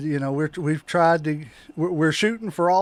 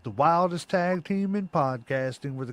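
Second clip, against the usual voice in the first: announcer voice; 4 semitones lower than usual. Acted. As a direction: hype podcast intro, big then dropping low. Podcast intro announcer.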